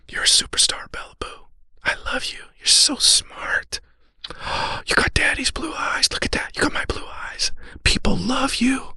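high, slow whispered blessing; tender whisper. Retold or imitated. Father hugging daughter in rain, whispering. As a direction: breathe it into her ear during a hug.

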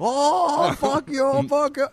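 mocking scammer swearing. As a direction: parody the caller's feeble swearing. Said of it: "And they go".

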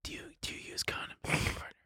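hushed, breathy whisper. Acted. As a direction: lean in and whisper it. Joking whispered intimate questions.

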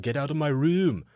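loud, high yelled quote; mock yell. Retelling the streamer's shouting at his partner, mock yell. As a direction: belt it like a furious streamer. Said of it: "in that Australian accent".

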